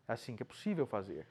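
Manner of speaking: quiet, fast repeat under the breath; soft echo. Shown as a demonstration. Teacher softly repeating the point to students, quiet and quick. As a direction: say it again softly to yourself.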